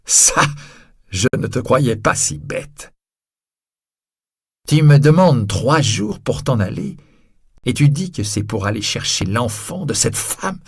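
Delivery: loud sneering taunt with laughter; mocking scorn. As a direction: jeer with cruel amusement. Javert mocking Valjean.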